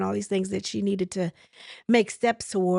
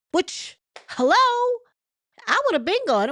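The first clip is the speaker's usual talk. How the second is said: incredulous; 13 semitones higher, 4 dB louder than usual. Real, not acted. Viewer reacting with disbelief.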